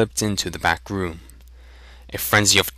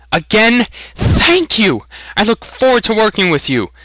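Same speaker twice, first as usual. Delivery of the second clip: much higher, bright eager thanks; delighted thanks; 12 semitones higher, 5 dB louder than usual. A narrator's reading. Young Aragorn thanking the blacksmith, delighted and much higher. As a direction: burst out with grateful joy.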